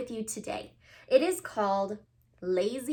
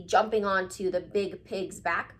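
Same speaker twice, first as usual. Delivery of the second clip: plain reading voice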